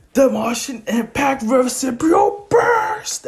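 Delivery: high mock battle yell, building to a long scream; anime finishing-move cry. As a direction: stretch the last move name into a big shout. Finishing attack called out.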